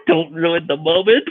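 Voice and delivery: high mock telling-off through laughter; laughing scolding. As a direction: imitate a date scolding while cracking up. Date's mock scolding, retold laughing.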